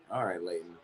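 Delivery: normal talking voice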